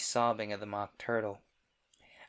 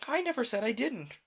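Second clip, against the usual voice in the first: put-on indignant girl; 12 semitones higher, 3 dB louder than usual. Alice retort.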